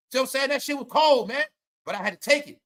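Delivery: normal talking voice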